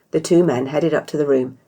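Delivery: ordinary delivery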